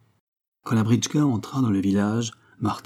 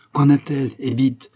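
high, louder impatient command; commanding, raised-pitch urgency; imperious; 5 semitones higher, 5 dB louder than usual. A narrator's reading. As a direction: demand an answer at once. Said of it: "fit Sava d'un ton impérieux".

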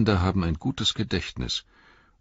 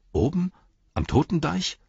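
higher-pitched, dubious innkeeper; doubtful question; 12 semitones higher than usual. A narrator's reading. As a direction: ask it with rising misgiving. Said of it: "schaute noch bedenklicher drein". (zweifelnde, höhere Stimme)

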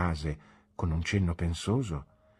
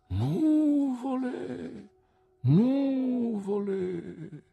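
very slow, drawn-out and higher; worried echo; much slower than usual. A narrator's reading. Boy echoes Mr Dick's worry, slow and drawn out. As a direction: stretch each word out, shaking your head.

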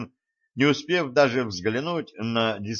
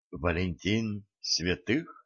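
soft, slow, silky caller; insinuating voice; 3 semitones lower, 7 dB quieter, slower than usual. Acted. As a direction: coax the name out slowly. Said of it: "послышался из трубки вкрадчивый голос". (вкрадчивый голос в трубке)

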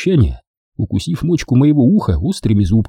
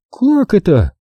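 put-on higher young woman's voice, teasing; Дженни; 10 semitones higher, slower than usual. A narrator's reading. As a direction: play the girlfriend, sweet and teasing. Jenny voiced. (нежная игривая Дженни)

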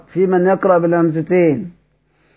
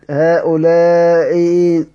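slow demo; much slower than usual. Shown as a demonstration. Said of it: "بنبدلها حرف مد".

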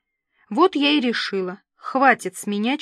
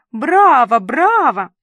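loud, delighted cheering; восторженное одобрение; 3 semitones higher, 9 dB louder, slower than usual. A narrator's reading. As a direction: cheer like a thrilled pet owner, big and higher. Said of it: "захлопала в ладоши Дуся". (громко, с восторгом)

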